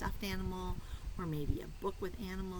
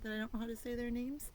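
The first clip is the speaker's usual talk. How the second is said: ordinary delivery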